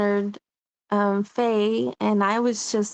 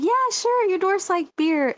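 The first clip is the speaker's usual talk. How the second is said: higher, mocking humor-the-kid tone; sarcastic dad; 8 semitones higher than usual. Father teasing his daughter about dwarves, mock sarcastic. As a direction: brush it off like a doubting father, lightly voiced. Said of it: "my dad's like".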